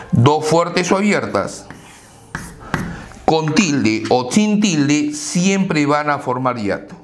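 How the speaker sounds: ordinary talk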